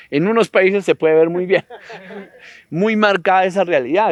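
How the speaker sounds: amused, emphatic rewording; laughing self-correction. Real, not acted. Self-censors for the recording, laughing.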